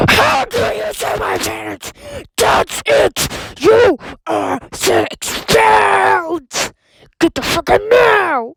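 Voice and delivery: high, loud raging yell; furious shout. Acted. Teacher screaming at the student he is expelled. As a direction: explode and expel him.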